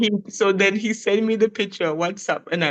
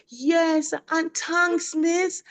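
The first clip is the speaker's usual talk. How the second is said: put-on higher, slow childlike thanks; little boy voice; 6 semitones higher, slower than usual. Teacher voicing her pupil's phone thanks. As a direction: lightly play the second grader. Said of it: "And he said, him say".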